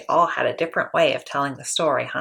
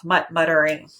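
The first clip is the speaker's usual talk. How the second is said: ordinary delivery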